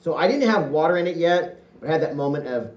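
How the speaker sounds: ordinary talk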